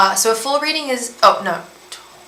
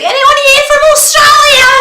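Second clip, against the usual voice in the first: very loud, high mock Aussie holler; Australian accent; 10 dB louder than usual. Aussie calling across a boat, hollered Australian accent. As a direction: bellow it like a rowdy Australian. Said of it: "there's a bunch of Aussies on the boat".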